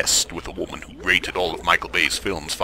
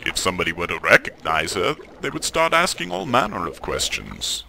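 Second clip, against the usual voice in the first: usual speaking voice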